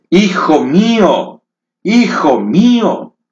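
high, slow, aching "hijo mío"; tender mother's cry. Retold or imitated. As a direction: call him as she embraces her son. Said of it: "lo abraza y le dice". (madre que abraza a su hijo, lenta y dolida)

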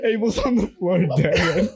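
crying with laughter; laughing so hard it turns to tears; shocked laughter. Real, not acted. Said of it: "I'm crying".

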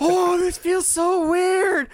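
put-on very high, slow uneasy kid voice; awkward teen. Fan voicing Peter in his new suit, high and uneasy. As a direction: squirm through it awkwardly. Imitating awkward Spider-Man.

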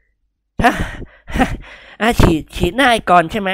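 loud, high gasping question; panting. A narrator's reading. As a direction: pant it out in terror. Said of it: "หอบแฮกๆ". (เสี่ยหงวนหอบแฮกๆ ถามด้วยความกลัว)

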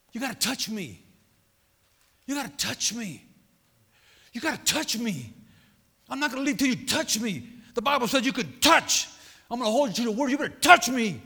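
plea building from measured to loud insistence; insistent demand. Acted. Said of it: "I'll get like that".

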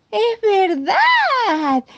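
high, slow, loud delighted discovery; joyful surprise. Acted. The cub discovering his hiccups are gone, delighted. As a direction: realise it with glee.

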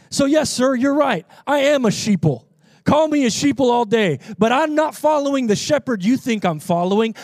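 high, loud, declamatory preacher; preaching at full force. Real, not acted. Preacher claims the Lord as shepherd, full-force preaching. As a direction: drive each phrase home to the congregation.

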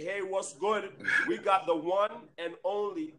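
ordinary talk, nothing marked

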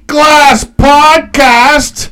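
high, loud, drawn-out podcast welcome; big hyped-up intro, stretching every word; show-opening announcer. Pretend. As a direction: belt out the show's welcome slowly. Opens the show in hype voice.

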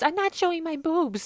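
Vicky repeating her refusal, loud high squeal; mock Vicky refusing again. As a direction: still shrieking, high and loud. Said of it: "Vicky being like".

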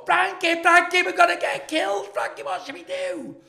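very high, loud frantic shriek, acted out; panicked screaming. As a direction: imitate a terrified girl screaming for help. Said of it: "The girls are screaming".

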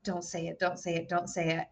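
soft, urgent repeated plea under the breath; hushed pleading at the TV; hushed whisper. Retold or imitated. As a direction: beg quietly, hoping she won't say it. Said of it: "I whispered".

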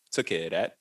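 low, quiet hitman's aside; hushed casual menace, mock tough; sly understatement. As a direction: drop it low and soft like a secret favor. Doll casually admits the killing.

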